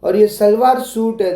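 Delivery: ordinary delivery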